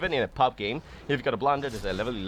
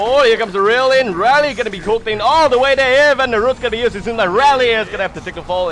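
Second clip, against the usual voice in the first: loud, high hype call; excited play-by-play; 10 semitones higher, 11 dB louder than usual. Real, not acted. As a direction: call the fight at full hype. Teamfight play-by-play.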